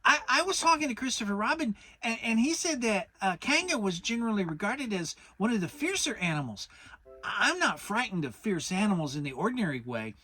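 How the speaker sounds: high, quick stammering Piglet; scared of the fierce animals; anxious small voice hurrying; nervous Piglet. A narrator's reading. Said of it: "fidgeting a bit".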